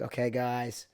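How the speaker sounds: put-on low-key regular American accent; plain, soft American off-set talk; casual everyday American voice; Damson Idris. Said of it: "he'll just talk like regular".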